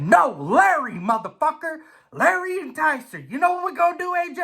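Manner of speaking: high, cocky hyped-up voice; idol's voice. Retold or imitated. Idol Larry egging the racer on, high and cocky. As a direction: sell it like a wild hype man. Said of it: "He said".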